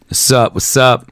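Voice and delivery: a low hip-hop "What's up?"; a laid-back gangster greeting, voice pitched low. Shown as a demonstration. Host warming up his hip-hop voice before the prank call. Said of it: "get my hip-hop voice on".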